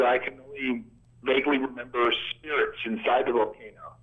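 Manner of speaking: ordinary delivery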